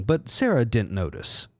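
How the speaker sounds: ordinary talk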